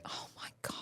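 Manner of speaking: breathless unvoiced whisper of shock; whispered oh my God. Mother hears her daughter needs stitches, whispered shock. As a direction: barely breathe the words in dismay.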